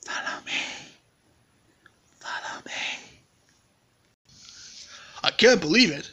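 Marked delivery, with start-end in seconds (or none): 0.0-0.5 eerie, unvoiced whisper. Mysterious voice beckons the bear to follow, whispered. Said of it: "My whispered voice said". 2.2-2.7 eerie, very soft whisper. Acted. Mysterious voice beckons the bear to follow, whispered. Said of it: "My whispered voice said".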